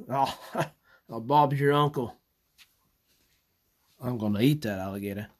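Australian-tinged hunter voice, laughing into it; goofy mock Australian alligator hunter. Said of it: "my alligator hunter voice".

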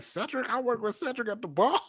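very high-pitched astonished quote; amazed disbelief. Retold or imitated. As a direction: imitate a coworker's stunned realization. Coworker amazed he knows Cedric.

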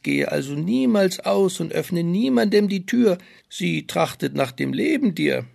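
high, eager little man's voice; a busy dwarf listing chores. Acted. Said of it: "sprach ein Kapuzenmann".

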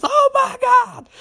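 very high, drawn-out mock shriek; mock horrified scream. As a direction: shriek it as the horrified nurse, hammed up. Acting the nurse finding the body.

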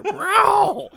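loud, high screeched meow; cat meow. Retold or imitated. Co-host imitating the cat on the show, loud yowl. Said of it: "You hear".

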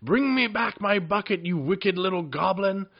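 high, loud furious witch shout; witch roaring in rage. A narrator's reading. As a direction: roar it after him in rage. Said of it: "shouting and roaring in rage".

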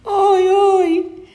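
very high, plaintive oi oi wail; high moaning oi. A narrator's reading. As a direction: moan like a trapped little animal. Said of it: "si lamentarono".

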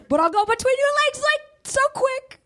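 high, fast and eager; excited. Acted. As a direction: rattle it off, all excited. Boasting about quick dodging.

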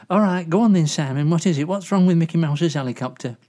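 ordinary delivery

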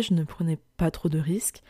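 ordinary talk

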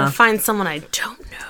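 a breathy whispered afterthought; hushed whisper, half to herself; whispered aside. Real, not acted. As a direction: whisper it to yourself.

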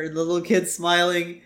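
ordinary delivery

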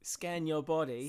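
slow, loud, high mock Cockney; drawn-out Cockney command; Cockney accent. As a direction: parody a meditation guide in broad Cockney. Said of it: "like, a, a Cagney accent".

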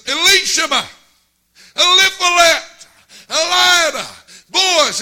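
loud high call across the yard. Retold or imitated. Father calling his boys in for supper, loud high call. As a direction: holler for the kids to come in.

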